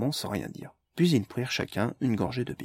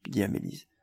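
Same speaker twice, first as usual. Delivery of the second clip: ordinary delivery, nothing marked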